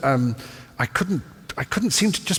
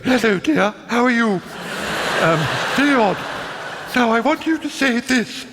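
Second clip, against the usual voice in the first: put-on high, strained elderly English lady on indrawn breath; breathing in while speaking; 11 semitones higher than usual. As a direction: speak while sucking the air in. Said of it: "she was breathing in when she spoke".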